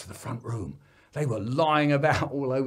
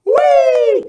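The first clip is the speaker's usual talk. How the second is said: gleeful; 19 dB louder than usual. Retold or imitated. Kids playing dive bomb.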